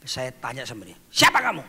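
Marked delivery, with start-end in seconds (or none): 1.1-1.6 loud demand for the spirit's name; commanding shout. Retold or imitated. Pastor confronting the spirit, loud demand. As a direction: challenge the demon loudly.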